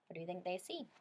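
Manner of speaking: plain reading voice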